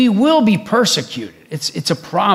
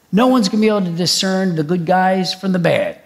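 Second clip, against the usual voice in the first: ordinary talk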